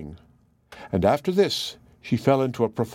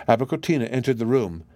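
ordinary delivery, nothing marked

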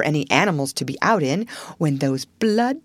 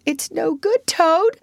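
high-pitched warning call; calling after someone; 9 semitones higher, slower than usual. A narrator's reading. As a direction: call him back, voice raised high. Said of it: "called the Rat after him".

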